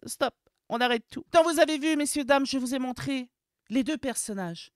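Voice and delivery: ordinary talk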